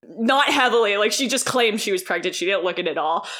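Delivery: ordinary delivery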